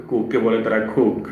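normal talking voice

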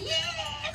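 a very high but quiet mock scream; retelling the girl's scream at the tomb, very high and quiet; imitated scream. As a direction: squeal it like a horror movie possessed girl. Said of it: "Y gritaba así".

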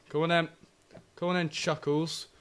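ordinary talk

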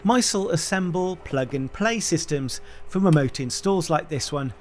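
ordinary talk, nothing marked

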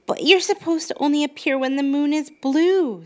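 put-on high, doubtful little girl; child objecting; puzzled girl voice. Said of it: "said Brooke".

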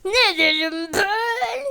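high, loud, slow squeal; mock squeaky shout. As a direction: stretch it out in a silly high voice. (yüksek perdeden uzatılmış şaka sesi)

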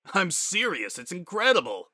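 high, emphatic gushing; excited insistence. A narrator's reading. Proud dad assures his disbelieving friend, gushing. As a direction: insist on it with delighted pride.

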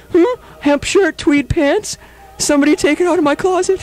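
high mock indignant voice; imitating Mark. Host mimics his cohost complaining about his stolen tweed. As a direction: squeak it up like a startled friend. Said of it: "his head just popped up".

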